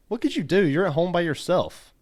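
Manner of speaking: high-pitched baffled question; incredulous questioning. Retold or imitated. Acted baffled reply to friend's text.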